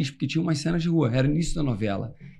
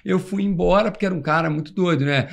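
normal talking voice

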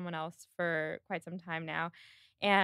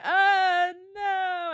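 high, drawn-out "oh no"; hammed-up heartbroken wail; mock anguished cry; 11 semitones higher, 7 dB louder than usual. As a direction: cry out in mock despair, loud then easing. Retelling Marianne hearing of the engagement.

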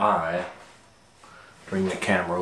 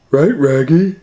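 high, loud Scooby growl-talk; Scooby-Doo voice; 9 semitones higher, 11 dB louder than usual. Acted. Scooby answers Shaggy, high loud dog voice. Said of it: "Right, Raggy".

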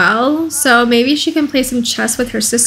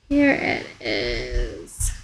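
much lower, very slow and drawn out; mock deep voice; 13 semitones lower, 9 dB quieter, much slower than usual. Streamer echoing herself on finding the park, in a deep slow joke voice. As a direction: drop into a silly deep slow-motion voice. Jokey echo of her own words.

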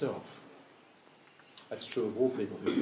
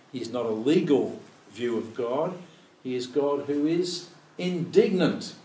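ordinary talk

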